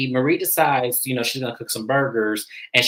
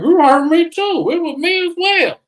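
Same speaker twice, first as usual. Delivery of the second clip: high, loud retort with stretched words; hurt protest; 5 dB louder than usual. Retold or imitated. Marie answering a friend's accusation, high-pitched hurt retort. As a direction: throw it back in a raised, high-pitched voice. Acting out a quarrel between friends.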